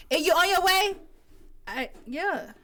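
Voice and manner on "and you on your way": nail tech's voice. Retold or imitated. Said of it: "she calling me".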